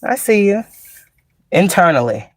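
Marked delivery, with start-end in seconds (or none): 0.0-0.6 high, knowing I see you; knowing okay. Retold or imitated. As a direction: say it with a knowing edge. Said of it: "Just be like".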